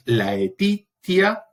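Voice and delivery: "etitia" said very slowly and drawn out; slow demo. Shown as a demonstration. Teacher showing a Latin word ending, said slowly. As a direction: spell the ending out slowly for the class. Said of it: "que es como tristitia".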